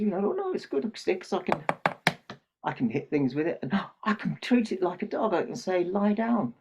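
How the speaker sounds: put-on high-pitched, eager boy showing off his stick; boy playing dog trainer, calling out commands; excited little boy. Boy explains his stick is good, excitedly.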